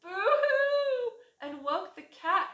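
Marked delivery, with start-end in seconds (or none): 0.0-1.1 high loud hammed-up boo-hoo; exaggerated fake sob; mock crying. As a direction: wail it like a cartoon rat. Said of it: "who cried".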